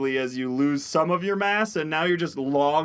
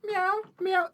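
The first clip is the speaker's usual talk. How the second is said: cat meow; 3 dB quieter than usual. Acted. Cats spreading through crowd.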